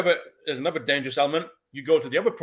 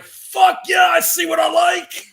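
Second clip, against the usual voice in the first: high, loud whooping yell; hammed-up lusty catcall; rowdy moviegoer shout; 10 dB louder, slower than usual. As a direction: holler it at the screen from the back row. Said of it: "people in that cinema would be like".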